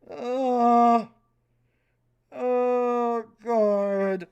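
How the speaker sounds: long, loud drawn-out groan; loud, held "oh" of dismay. Real, not acted. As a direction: groan at awful writing. Groaning at the script read aloud.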